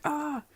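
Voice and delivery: acted high-pitched cry at the cold; high mock yelp. Acting Todoroki's cry at cold.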